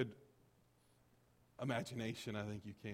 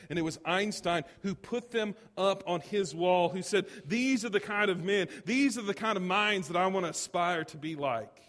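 loud, high sermon crescendo; emphatic preaching; 7 semitones higher, 8 dB louder than usual. Acted. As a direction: drive each phrase home from the pulpit. Preacher builds to Einstein's quote.

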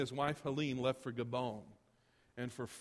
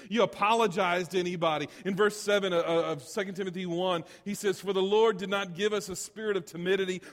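loud, raised preaching voice; forceful exhortation; 6 semitones higher, 9 dB louder than usual. Acted. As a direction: urge the congregation on with full force. Preacher exhorts, quoting Paul.

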